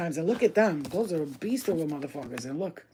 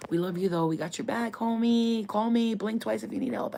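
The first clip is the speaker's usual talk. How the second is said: lightly raised, sing-song reassurance; playful mock support; 4 semitones higher than usual. Reader offering the FBI agent rescue, playful mock support. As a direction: call out to a secret pal in jest.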